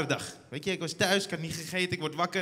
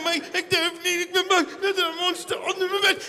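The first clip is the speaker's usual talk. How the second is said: high, louder whiny plea; hammed-up scared kid; mock panicked child; 10 semitones higher, 6 dB louder than usual. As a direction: plead with dad in panic. Child pleading again.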